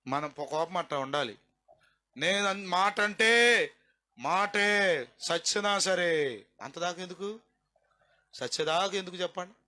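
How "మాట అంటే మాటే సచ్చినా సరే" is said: each word dragged out slowly and loudly; mock the proud grudge-holder, word by word; hammered, slow mock vow; stubborn vow, slowed. Preacher voicing stubborn grudge.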